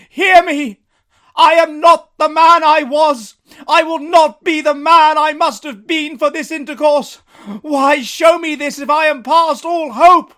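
high, loud, slow imploring; Scrooge's desperate plea. Acted. Scrooge begging the spirit for hope, loud plea. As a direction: plead with all you have left.

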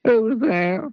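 high, squeaky and forlorn; a small sad creature calling out from behind the door; squeaky little voice asking who's there. A narrator's reading. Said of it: "Sad, squeaky sort of voice".

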